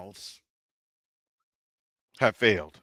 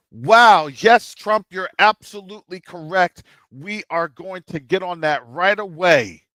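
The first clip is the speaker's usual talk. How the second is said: high, louder, overeager yes-man; mock eager agreement; 4 semitones higher, 7 dB louder than usual. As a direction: gush at Trump like a fawning official, with sarcasm. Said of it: "you would say".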